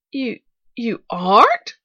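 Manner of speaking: slow, loud rising question; stammered dismay. A narrator's reading. Little girl learning this is not her aunt, dismayed. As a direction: falter in plain dismay. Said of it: "stammered the little girl in plain dismay".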